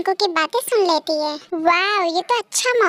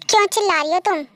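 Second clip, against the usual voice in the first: normal talking voice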